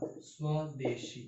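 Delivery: very slow and soft स्वदेशी; slow stressed answer. Shown as a demonstration. Teacher giving the final answer to students, slow and soft. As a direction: draw out स्वदेशी gently. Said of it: "stretched answer".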